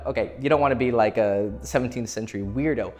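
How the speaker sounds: ordinary talk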